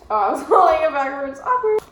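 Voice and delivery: fast, loud laughing admission; laughing at herself. Real, not acted. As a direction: laugh off the mistake. Shirt held backwards.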